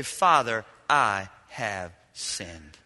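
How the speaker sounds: low, very slow and hushed repentance; humble confession. Pretend. Repentant son to his father, slow humble confession. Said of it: "and you say".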